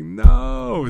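high, loud, drawn-out protest; retold dismayed cry. Retells own dismayed reaction.

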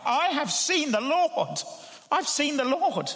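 Mary cries out her news to the disciples, overjoyed; joyful cry. Retold or imitated. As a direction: burst out as if you can barely believe it. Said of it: "she says with a cry".

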